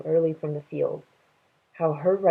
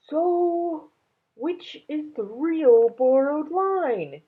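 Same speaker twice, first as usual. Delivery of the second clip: a higher dragon voice, slow and wondering; Dragon's voice; 10 semitones higher, much slower than usual. A narrator's reading. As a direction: ponder it aloud like the dragon. Dragon asks Minley.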